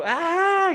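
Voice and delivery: sudden high excited yell; grito de emoción. Retold or imitated. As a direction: let out a joyful shout. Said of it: "Gritando así en el parque". (grito alegre y agudo)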